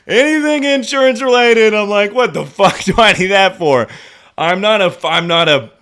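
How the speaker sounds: loud, high, worked-up rant; shouting about insurance with exasperation. Real, not acted. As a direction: rant it out at full volume.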